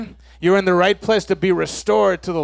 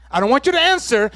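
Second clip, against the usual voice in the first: ordinary talk, nothing marked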